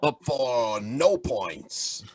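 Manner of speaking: drawn-out commentator impression; imitated commentator, very slow delivery; Mike Shaft. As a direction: stretch it like a basketball commentator's call. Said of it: "That sound just like him".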